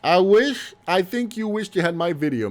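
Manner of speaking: ordinary talk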